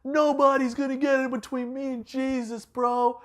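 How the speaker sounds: high, goofy surfer-bro voice; a loudmouth bro gushing over his buddy; brash bro Peter. Retold or imitated. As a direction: play Peter as a cocky bro. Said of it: "He was always like".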